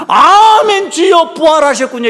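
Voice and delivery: high, loud, laughing cry of joy; joyful exclamation. Pretend. Disciples greeting the risen Jesus, a loud joyful shout. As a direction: burst out in delighted amazement at the risen Lord.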